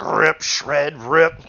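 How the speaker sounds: loud, punched-out action words; savage ripping. Retold or imitated. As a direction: punch each word like tearing something apart. Acting out the character tearing apart.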